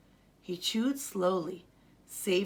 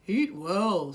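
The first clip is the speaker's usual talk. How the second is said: louder father's advice; a hint of a fatherly voice; Baba's voice; 6 dB louder than usual. Father urges the family to eat well, lightly voiced. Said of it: "said Baba".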